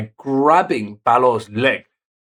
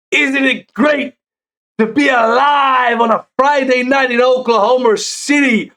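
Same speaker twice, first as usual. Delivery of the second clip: higher, louder, dragged-out wrestling promo shout; crowd-rousing call; 9 semitones higher, 4 dB louder than usual. Retold or imitated. Recapping the champ's promo, he belts the closing question up high. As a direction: stretch it out to pop the crowd.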